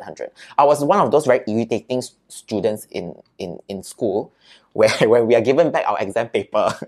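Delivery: ordinary talk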